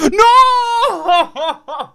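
loud high wailing cry; anguished shout. Real, not acted. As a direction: cry out in despair. Dramatic loss reaction.

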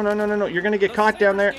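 ordinary delivery

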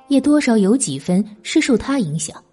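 usual speaking voice

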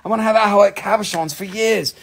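excited inner voice. Retold or imitated. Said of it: "I was like".

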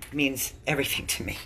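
drops to a breathless whisper; real whisper. Real, not acted. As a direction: let the words fall to a whisper, as if overcome. Emotional plea about her life.

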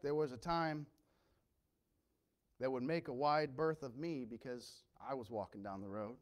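ordinary talk, nothing marked

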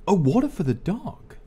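usual speaking voice

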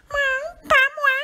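very high, loud mewing cat. A narrator's reading. Cat refusing to help the hen, high mewing voice. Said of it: "dit le chat".

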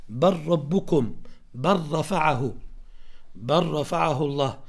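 "بل ربكم" said bar-rabbukum, doubled r; "بل رفعه" as bar-rafa'ahu with no lam; lam merged into ra. Shown as a demonstration. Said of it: "اللام مع الراء".

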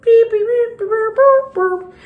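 high, loud sing-song beeps; high beeping. Pretend. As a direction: beep like a little machine. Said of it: "Let me stop doing that".

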